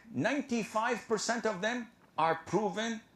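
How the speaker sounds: normal talking voice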